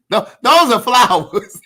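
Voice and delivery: flowers said slowly through real laughter; laughing correction. Real, not acted. Laughing at chat who wrote perennial, slow correction. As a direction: laugh while setting the word straight. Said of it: "Not perennial".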